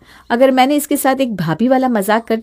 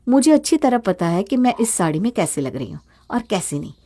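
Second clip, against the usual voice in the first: usual speaking voice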